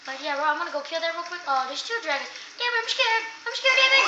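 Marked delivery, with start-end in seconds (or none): 2.5-4.1 high, louder frightened candlestick; the little sidekick panics; scared candlestick. Acted. Candlestick begs David, high and frightened.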